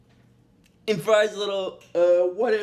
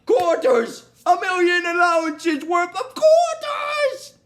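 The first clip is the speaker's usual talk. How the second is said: Donkey Kong demand; 7 semitones higher, 4 dB louder than usual. Retold or imitated. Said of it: "Donkey Kong's like".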